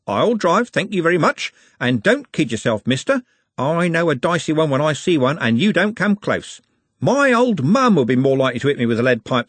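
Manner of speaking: see off a would-be tough with cheery scorn; cocky bus driver. Acted. Driver turns down Trev's offer to drive, cocky.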